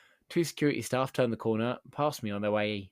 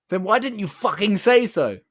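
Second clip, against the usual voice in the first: higher, much louder outraged yell; furious shout; 9 semitones higher, 11 dB louder than usual. Retold or imitated. Customer realising he isn't staff, furious loud yell. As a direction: explode at him for wasting your time.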